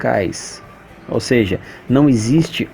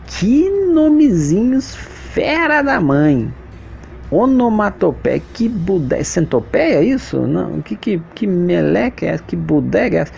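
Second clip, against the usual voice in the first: high, exaggerated whiny kid complaining about strange names; confused student; 6 semitones higher than usual. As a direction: squeak it out like a puzzled kid baffled by the word. Teacher voices a confused student.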